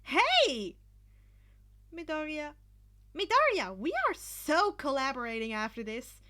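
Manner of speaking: loud, high, gleeful calling out; excited cheer. A narrator's reading. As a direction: Hatsume cheers at Midoriya mid-air, loud and excited. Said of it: "Hatsume cheered".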